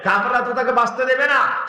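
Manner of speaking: ordinary delivery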